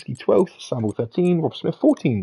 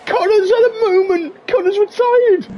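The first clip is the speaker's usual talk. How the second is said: shocked outcry; 4 dB louder than usual. Real, not acted. Shocked commentator reacting to retirement.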